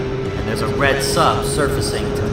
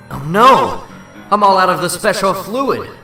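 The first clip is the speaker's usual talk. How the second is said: higher, louder cry of dismay; dismayed exclamation; 6 semitones higher, 5 dB louder than usual. Acted. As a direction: blurt it out as your plan suddenly fails. Sudden setback, out of web fluid.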